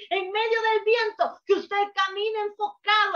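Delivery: real emotion breaking through the preaching. Real, not acted. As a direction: let the voice crack with feeling.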